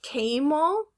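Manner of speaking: "camel" slowly as KAY-mul, rising; long A. Shown as a demonstration. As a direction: say "camel" with the a of day. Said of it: "Is it".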